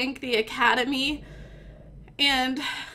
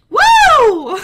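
a high, loud whoop that dissolves into laughter; hammed-up victory whoop with a laugh; whooping cheer; 10 semitones higher, 12 dB louder than usual. Winner whooping at her own award, loud and laughing. Celebrating employee of the month win.

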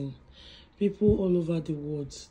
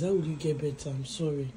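ordinary talk

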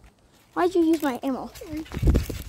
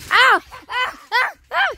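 screams; 10 semitones higher than usual. Real, not acted. Gets shot on the trampoline.

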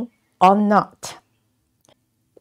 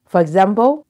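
ordinary talk

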